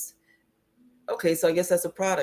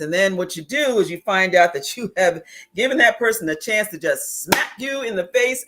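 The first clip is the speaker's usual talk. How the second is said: ordinary talk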